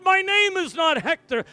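high, loud mock demon reply; a hint of a demon's defiance. Demon speaking through Hector.

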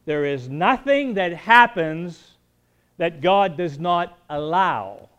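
slow, loud preaching emphasis; emphatic declaration. Real, not acted. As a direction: hammer the point home to the congregation. Preacher's closing point.

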